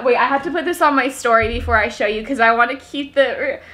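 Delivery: normal talking voice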